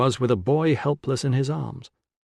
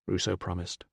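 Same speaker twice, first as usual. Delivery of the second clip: ordinary delivery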